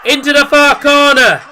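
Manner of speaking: high, loud, slowed excited call; excited commentary. Real, not acted. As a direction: keep the goal buzz going. Describing goal, still excited.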